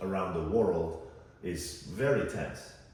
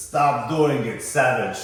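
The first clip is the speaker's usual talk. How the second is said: put-on loud, higher drunken Russian accent; 7 semitones higher, 12 dB louder than usual. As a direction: yell it like a drunk Russian heckler. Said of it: "a drunken voice with a Russian accent says".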